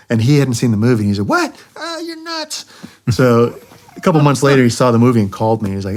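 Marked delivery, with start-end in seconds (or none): 1.3-2.7 high, softer squeal in a mock Puerto Rican accent; Willie. Wingman hearing the crazy plan, incredulous Puerto Rican accent. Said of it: "very, very thick accent when he gets excited".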